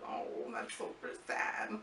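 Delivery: breathy whisper, slow and soft; hushed sad aside. Retold or imitated. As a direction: drop to a whisper, genuinely moved. Sad reaction at goodbye.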